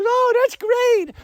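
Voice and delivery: very high, slow mock-sweet woman's voice; delighted woman. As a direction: stretch it out high like a thrilled young woman. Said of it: "And someone goes".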